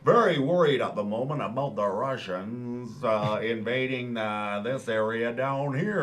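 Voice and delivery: put-on American commentator; American accent. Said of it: "do my American accent now".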